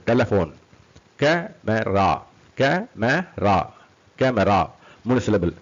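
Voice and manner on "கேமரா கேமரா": very slow CA - ME - RA, gaps between; syllable by syllable. Shown as a demonstration. Teacher demonstrates three syllables, syllable by syllable. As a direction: separate each syllable of camera.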